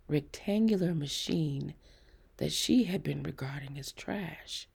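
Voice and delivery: ordinary delivery, nothing marked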